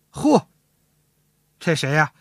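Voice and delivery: ordinary talk, nothing marked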